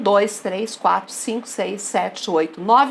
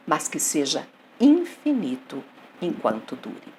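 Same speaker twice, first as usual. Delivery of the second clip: poem recitation; 5 semitones lower than usual. Acted. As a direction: recite the sonnet with weighted pauses. Recites a sonnet to the class. (declamação pausada de poema)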